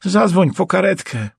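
high-pitched pained plea; moaned plea. A narrator's reading. Injured man begging for an ambulance, moaning. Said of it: "wyjęczał".

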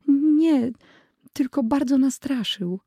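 put-on high, shaken little girl. Sister telling her brother she was only frightened, high voice. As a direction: answer like a scared small girl.

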